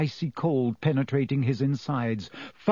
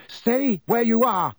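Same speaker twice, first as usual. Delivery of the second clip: slow, high-pitched shout; shouted command; 9 semitones higher, 4 dB louder than usual. A narrator's reading. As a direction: shout it as an order, drawn out. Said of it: "Harry shouted".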